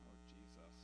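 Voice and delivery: low, hushed sigh of a prayer; quiet heavy-hearted murmur; sorrowful aside. Real, not acted. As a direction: breathe it out under your breath, grieved. Aside after a grim statistic.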